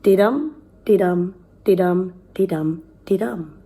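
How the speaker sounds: didum spoken as da-DUM, with the stress on dum; iambic beat. Shown as a demonstration. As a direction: tap out didum like a heartbeat. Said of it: "iambic pentameter".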